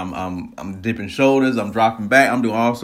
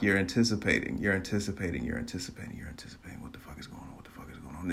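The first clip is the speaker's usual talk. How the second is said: fading into a whisper; acted suspense, softer with each repeat; hushed anticipation; 2 semitones lower, 10 dB quieter, faster than usual. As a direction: repeat it, shrinking to a tense whisper. Acting out tense wait before the run.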